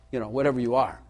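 ordinary talk, nothing marked

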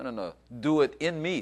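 ordinary delivery

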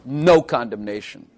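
"No" punched first, the rest falling away; emphatic, weighty "No" up front; stress on No. Shown as a demonstration. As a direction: put all the emphasis on "No". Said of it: "first word is the most emphatic word".

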